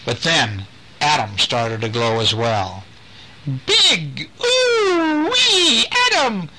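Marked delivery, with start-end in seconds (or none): 3.6-6.5 scream. Acted. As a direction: shriek the words out in a panic. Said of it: "Mandy screamed".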